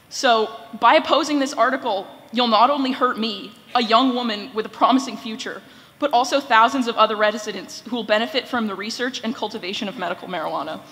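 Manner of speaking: usual speaking voice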